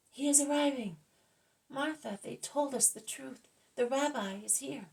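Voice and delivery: high, loud cry of joy; joyful loud shout. A narrator's reading. Mary announces the rabbi's arrival to the house, shouting joyfully. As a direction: call it out loudly so the whole house hears. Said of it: "shouts loudly, to be heard by everybody".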